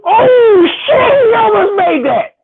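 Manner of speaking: loud high gasping shout; excited outcry. Real, not acted. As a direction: yell as the shot nearly drops. Near miss buzzer shot.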